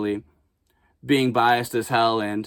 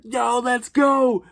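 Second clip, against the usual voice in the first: mock hype; 10 semitones higher, much slower than usual. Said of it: "And I was like".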